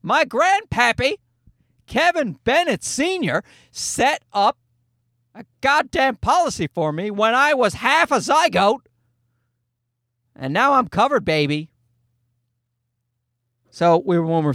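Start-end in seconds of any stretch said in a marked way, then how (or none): 0.0-11.7 put-on old James gloating. Grown son at seventy mocks insurers, gloating old man. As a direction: crow it like a smug grandpa. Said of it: "He's like".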